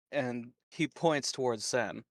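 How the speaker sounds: usual speaking voice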